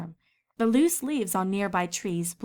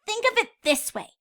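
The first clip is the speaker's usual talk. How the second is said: much higher, louder eager filly; young filly Scootaloo; 8 semitones higher, 4 dB louder than usual. A narrator's reading. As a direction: spell it out proudly, high. Filly persuades.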